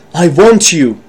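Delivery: mildly hammed-up enthusiasm; a touch of acted eagerness; enthusiastic voice. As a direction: play an eager interviewer, lightly. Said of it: "in a kind of a enthusiastic voice".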